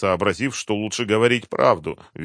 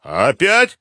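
high, loud menacing question; threatening; 9 semitones higher, 9 dB louder than usual. A narrator's reading. Colonel catches captive forgetting again, threatening. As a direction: challenge him with a threat behind it. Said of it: "с угрозой в голосе".

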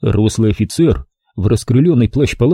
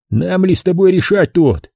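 досадливо; 7 semitones higher than usual. A narrator's reading. Капитан досадливо отмахнулся.

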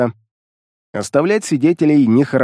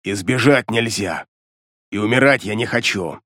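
low, menacing demon; 3 semitones lower, 3 dB quieter than usual. A narrator's reading. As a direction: speak as a sinister demon. Demon Beliar speaks.

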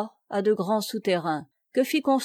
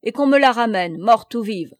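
louder, hard-edged order; imperious command; 7 dB louder than usual. A narrator's reading. Plélan demands the girl back, imperious and loud. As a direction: leave no room for argument. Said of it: "commanda impérieusement".

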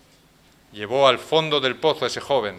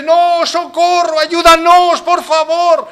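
much higher, slow desperate cry; pleading for help; 13 semitones higher, 9 dB louder, much slower than usual. Acted. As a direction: beg for rescue, high and drawn out. People cry from the pit. (súplica desesperada, muy aguda y lenta)

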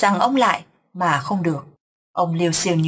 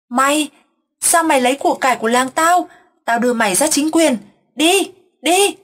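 higher and louder, jabbing at a stranger; crazed accusation; 7 semitones higher, 4 dB louder than usual. A narrator's reading. Deranged grandfather accusing passersby, angry shouting. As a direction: accuse every passerby like a thief. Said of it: "gặp ai ông cũng chỉ vào nói".

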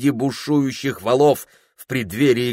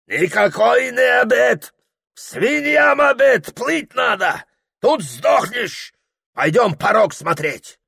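high, slow furious yelling; angry shout; 12 semitones higher, slower than usual. Acted. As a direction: bark down the idea of dinner. Ibragim refuses dinner angrily. (гневный окрик)

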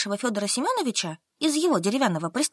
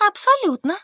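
put-on higher, stubborn young woman; Solka answering; 10 semitones higher, 4 dB louder than usual. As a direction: answer flatly, digging in. Solka denies. (выше, упрямая Солька)

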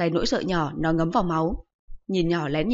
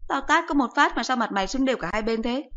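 voiced higher, a sharp nagging mum; higher-pitched mocking mother voice; scolding mother; 6 semitones higher than usual. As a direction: scold the son like an exasperated mother. Mother retorting.

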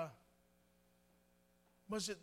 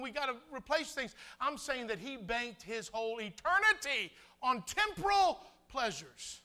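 raised, forceful sermon voice swelling to loud peaks; impassioned preaching; 4 semitones higher, 8 dB louder than usual. Real, not acted. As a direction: preach hard, driving each point home.

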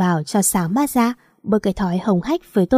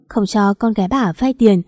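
plain reading voice

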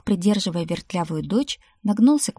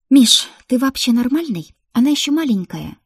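loud, raised-pitch outrage; indignant scolding; 6 semitones higher, 8 dB louder than usual. A narrator's reading. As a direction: berate a brother in disbelief. Sister scolds brother over the gift. (возмущённо, громко отчитывая)

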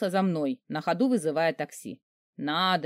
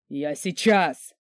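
низкий медленный рявк великана; рявкнуть грозно и тягуче, ниже своего голоса; рявкнул; 4 semitones lower, much slower than usual. Acted. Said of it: "рявкнул господин Воронцов". (великан рявкает на героиню, низко и медленно)

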